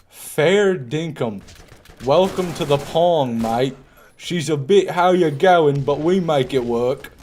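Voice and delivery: put-on over-the-top Australian accent, drawn out. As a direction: play a cartoon Aussie bloke greeting mates in an Australian accent. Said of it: "I went from American to Australian".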